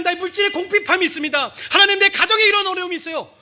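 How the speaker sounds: high, loud, pleading cry to God; wailing prayer. Acted. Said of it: "예, 기도하잖아요".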